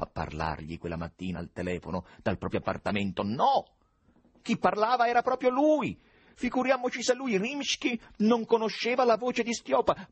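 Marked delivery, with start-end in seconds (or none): 3.2-10.1 high, emphatic, incredulous insistence; indignant protest. A narrator's reading. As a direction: insist loudly, pitched right up. Indignant inner protest, narrated. (protesta indignata, acuta e concitata)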